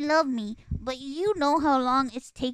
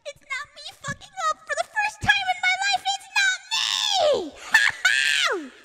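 very high-pitched reenacted glee; gleeful celebration; 3 dB louder, faster than usual. Sibling relieved sister is in trouble, gleeful squeal. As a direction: squeal it out in triumph.

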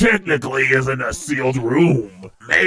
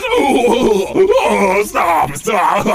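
squealing mock protest through giggles; ticklish laughing; much slower than usual. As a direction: beg them to stop while being tickled. Acts being tickled, laughing.

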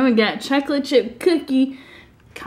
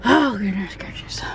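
whispered; 3 dB quieter than usual. Real, not acted. Sighing at long red light.

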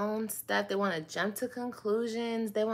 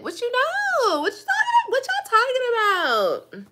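much higher, squealing mock busybody; mock gossip voice; 8 dB louder, faster than usual. Mimicking nosy viewers, high squealing mock gossip.

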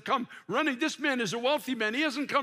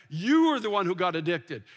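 normal talking voice

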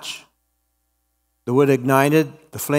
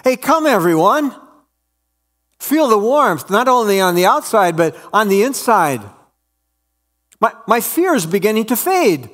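excited call; 7 semitones higher than usual. Acted. Woman calls others to warmth.

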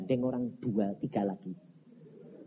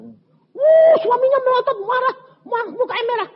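very high, loud dramatic telling; heated retelling; 11 dB louder than usual. Retold or imitated. Preacher tells how the husband glared red with anger, heated. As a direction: shout the story out at a high pitch.

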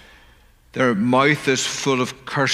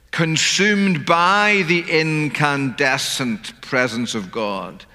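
ordinary delivery

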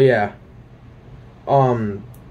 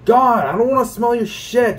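disgusted outburst; 8 semitones higher than usual. Retold or imitated. Ranting to viewers about a stranger's fart, disgusted. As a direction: pitch jumps up, disgusted and fed up.

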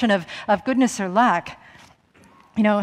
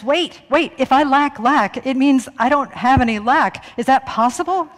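higher, louder voice of a perplexed ego; puzzled ego; 5 semitones higher, 5 dB louder than usual. Acted. The ego questions a double negative, insistent bewilderment. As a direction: stop short and puzzle it out insistently.

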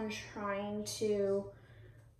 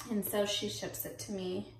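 normal talking voice

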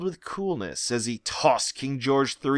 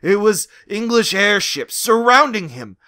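plain reading voice

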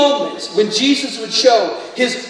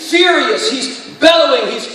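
preaching at full force; 3 semitones higher than usual. Real, not acted. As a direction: preach his fury at death. Preaching Jesus' fury at death.

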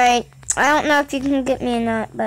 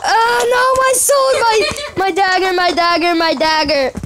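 dismayed wail; 5 semitones higher, 4 dB louder than usual. Real, not acted. Loses his sword in game.

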